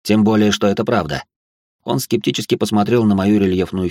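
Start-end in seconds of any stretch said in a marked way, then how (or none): none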